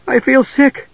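high, queasy worry; high-pitched, worried guard; truly worried. Acted. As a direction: fret that you've been poisoned. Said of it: "now sounding truly worried".

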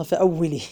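فأولي with an i on the doubled waw; kasra on shadda. Shown as a demonstration. Teacher citing his footnote's vowelling, kasra on the shadda. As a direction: pronounce فأولي as fa-awwilī. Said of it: "بالكسر، وهي بالكسر".

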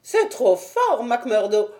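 high-pitched gentleman, put out; Thaddeus, indignant. Acted. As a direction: complain in a high, affronted voice.

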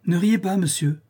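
high, mildly offended order; lightly acted indignation; indignant scolding. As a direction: scold someone for laughing at you. Countess scolds her laughing lover.

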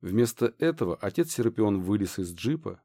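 plain reading voice

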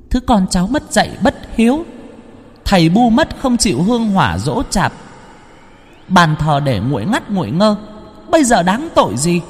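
put-on high, sharp scolding from a child ghost; scolding shout. Girl ghost scolds the unfilial daughter, high and shrill. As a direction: bark the charges down at her, high-pitched. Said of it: "mà quát".

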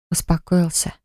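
soft breathy tender word; tender whisper. Acted. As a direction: whisper it gently, soothing. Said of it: "прошептала нежно". (нежный шёпот)

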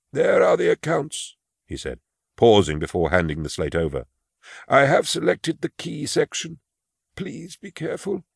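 put-on high, timid old clerk voice; a frail old clerk confessing his fear; old man Imus. Imus hands over slate.

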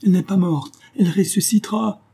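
high-pitched desperate cry; screamed in terror. A narrator's reading. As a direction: cry out high, pleading for your life. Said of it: "hurla-t-il". (hurlement désespéré, aigu)

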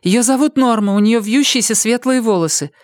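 put-on high voice of a woman taking charge; woman character. Mary gives Jared instructions.

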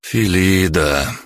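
slow, exasperated sigh of a name. A narrator's reading. Good cop whose role failed, sighing at partner. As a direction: draw the name out in resignation. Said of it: "вздохнул я".

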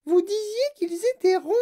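put-on very high, loud child character voice; Veruca Salt. A narrator's reading. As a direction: protest in a piercingly high voice. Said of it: "dit Veruca Salt". (voix suraiguë de fillette qui proteste)

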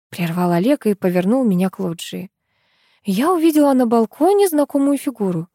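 ordinary delivery

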